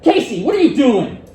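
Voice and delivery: loud, high, stern demand; sharp loud reprimand; angry scolding tone. Shown as a demonstration. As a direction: snap at the student like a cross parent. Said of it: "I used exactly the same words right there".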